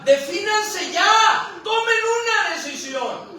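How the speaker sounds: loud, high-pitched preacher's ultimatum; urgent demand. Acted.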